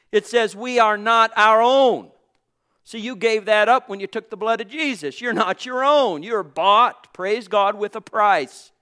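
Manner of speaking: joyful exclamation to the congregation; fervent preaching. Acted. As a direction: preach it out with fire. Preaching at full force.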